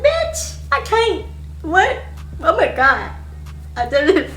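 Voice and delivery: very high, panicky; panicked squeal. Retold or imitated. Realising she's going to jail, panicked. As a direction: lose it completely.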